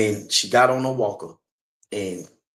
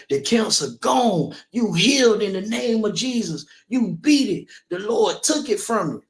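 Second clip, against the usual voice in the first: preacher proclaiming; 7 semitones higher than usual. Retold or imitated. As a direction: pronounce it like a pastor at the pulpit. Pastor declaring her healed.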